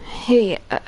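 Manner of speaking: ordinary talk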